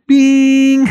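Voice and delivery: high, drawn-out sung "bing"; tuning fork bing. Retold or imitated.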